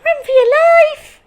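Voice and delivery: very high, loud scream of alarm; panicked yell. Acted. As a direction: scream at everyone to flee. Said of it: "yelled Rabbit".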